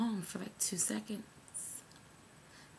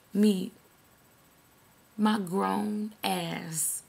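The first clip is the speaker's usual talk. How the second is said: ordinary talk, nothing marked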